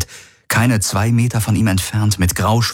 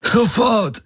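drängend. A narrator's reading. Galen fordert unter Schmerzen.

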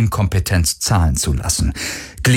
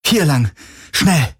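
urgent call; 7 semitones higher than usual. Acted. Urgent escape, calling companion.

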